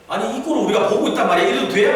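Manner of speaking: fast, high, indignant outburst; acted righteous outrage; indignant protest. Acts David indignant at the sight.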